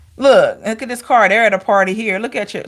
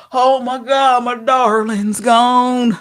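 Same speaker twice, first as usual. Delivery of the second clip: slow, hammed-up lament over a lost darling; mock wail for a gone lover; mock heartbroken; slower than usual. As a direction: drag out the grief like a sad love song. Mock heartbroken sobbing quote.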